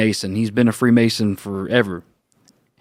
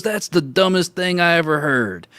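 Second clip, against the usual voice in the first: higher scornful put-down; mocking; 6 semitones higher than usual. Older coworker mocking him to everybody, scornful. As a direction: sneer it to the whole room. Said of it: "making fun of me in front of everybody".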